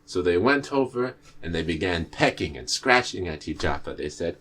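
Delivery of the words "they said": ordinary delivery, nothing marked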